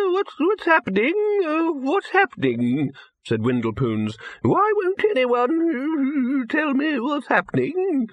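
put-on high, slow panicky old wizard; a frail old man demanding answers; quavering old man. A narrator's reading.

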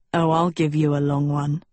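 low, amused prince voice; grinning. Acted. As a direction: say it with a grin. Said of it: "he grinned".

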